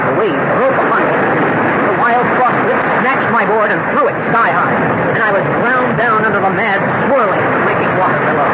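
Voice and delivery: urgent, loud telling of the wipeout; dramatic rising narration. A narrator's reading. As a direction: build it like the surf is on top of you. Narrating being crushed by a wave.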